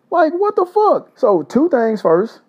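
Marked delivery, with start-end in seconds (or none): 0.1-1.2 high, slow what the fuck; can't believe the horse is on the track; stunned disbelief. Real, not acted. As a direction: drag out what the fuck way up in shock. Horse thief rides onto track.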